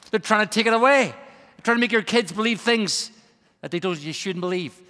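ordinary talk, nothing marked

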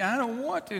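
put-on high little-girl whine; child near tears. Girl beginning to cry at her mother, whining. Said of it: "Now she's beginning to cry".

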